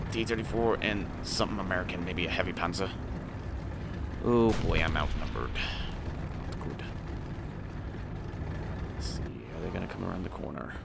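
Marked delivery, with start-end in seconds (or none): none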